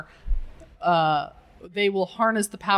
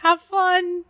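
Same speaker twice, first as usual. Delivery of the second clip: higher, mock-polite well-wishing; see him off with hesitant, pitched-up encouragement; awkward send-off; 7 semitones higher, slower than usual. Team bids dinosaur man farewell, mock-encouraging and higher.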